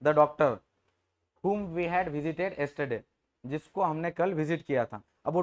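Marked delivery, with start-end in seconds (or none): 0.0-3.0 very slow, each English word laid out; slow example sentence. Shown as a demonstration. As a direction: read the example slowly for the class. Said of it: "अपने दोस्त को बोल रहे हो कि".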